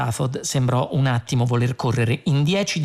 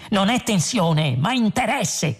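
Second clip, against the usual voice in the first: raised pitch without much volume; mild acted shout; 6 semitones higher than usual. A narrator's reading. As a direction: protest in a slow, high-pitched cry. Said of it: "gridò Zaphod". (grido contenuto, più acuto)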